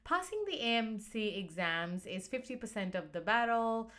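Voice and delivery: normal talking voice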